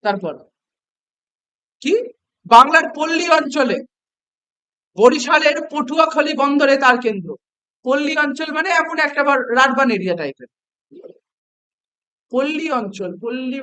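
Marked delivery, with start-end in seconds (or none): 2.4-7.3 louder, deliberate text reading; reading aloud. Acted. Teacher reading the textbook passage to students, loud and deliberate. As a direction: read the passage out for the class.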